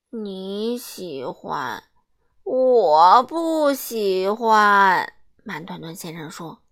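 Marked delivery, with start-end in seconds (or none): 0.1-5.1 very slow, drawn out; lazily disagree; sleepy drawn-out contradiction; slow grumble. Acted.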